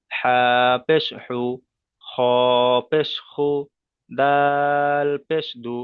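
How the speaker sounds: slow measured recitation, বা held long before পেশ বু; teacher's model chant, দাল stretched, ending on দু; spelling drill. Shown as a demonstration. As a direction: draw out each letter name, then পেশ and a clear "u" syllable. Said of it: "তিনটে সব উচ্চারণ করলাম".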